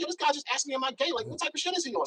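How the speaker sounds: high-pitched, incredulous griping; retold indignant complaint. Man retelling his complaint to the host's girlfriend, indignant. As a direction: act out an outraged report of an insult.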